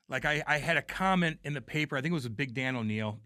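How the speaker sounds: ordinary delivery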